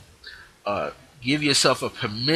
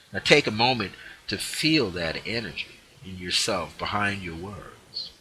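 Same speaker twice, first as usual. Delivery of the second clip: usual speaking voice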